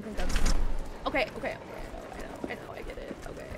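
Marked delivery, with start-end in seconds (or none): 1.6-3.5 whispered irritated okays; hushed under-breath reply; whispering. Real, not acted. Muttering at game.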